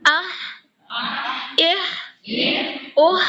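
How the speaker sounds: آہ اہ اوہ each breathed from the throat; ha drills. Shown as a demonstration. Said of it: "نکل رہا ہے". (آہ اہ اوہ ہوا کے ساتھ)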